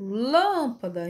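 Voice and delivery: "lâmpada" with a long first syllable; stressed syllable drawn out. Shown as a demonstration. Teacher showing the stressed syllable, "lâmpada" with "lâm" held long. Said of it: "se eu demorava mais em lâmpada".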